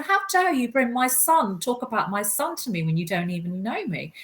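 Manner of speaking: high, indignant telling-off; outraged retort, acted out; fiery anger. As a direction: re-enact confronting him, pitch leaping up. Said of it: "I can be quite fiery".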